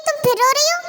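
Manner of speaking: normal talking voice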